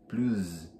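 plus whispered with a buzzing Z at the end, plooz; Z sound. Shown as a demonstration. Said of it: "ora tem som de Z".

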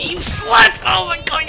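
angry shout. Real, not acted. Gamer yelling an insult at an enemy player, angry shout. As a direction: blast it at the enemy.

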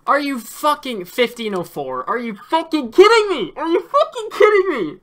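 loud, high enraged ranting; furious yelling. Real, not acted. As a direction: rage at the game, yelling. Run lost at the very end.

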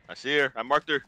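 loud urgent callout; shouting callout. Real, not acted. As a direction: call it out loud. Urgent callout spotting enemy.

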